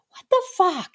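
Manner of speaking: very high, squeaky bird voice; high-pitched cartoon bird exclamation; little bird voice. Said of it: "That's what that bird is saying".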